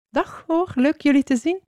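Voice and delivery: high, sing-song hello; friendly greeting. A narrator's reading. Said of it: "zei struiken, bomen en huizen gedag". (vriendelijke, hoge begroeting)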